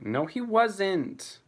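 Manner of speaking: high, drawn-out defensive objection; protesting. Real, not acted. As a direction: leap to a beloved character's defence, stretching it. Objecting to viewer calling dog terrifying.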